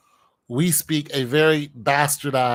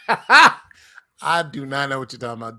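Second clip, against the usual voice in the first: laughing; 7 dB louder than usual. Real, not acted. Teased about doing an accent.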